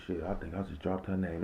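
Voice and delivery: usual speaking voice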